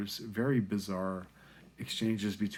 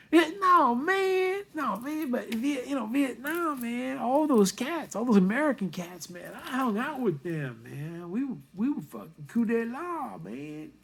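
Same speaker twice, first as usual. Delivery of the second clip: high, loud imitated hipster patter; Vietnamese student impression; 5 dB louder than usual. Student bragging about American cats he knew, high-pitched impression. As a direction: pitch way up as the excitable cool guy. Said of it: "he was a Vietnamese guy".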